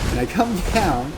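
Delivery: ordinary delivery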